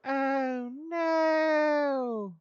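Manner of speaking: very loud, high, drawn-out mock cry; forced mock wail. Player hamming up dismay in a forced accent, loud high wail. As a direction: overdo a big wailing cry of dismay. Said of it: "that was too forced".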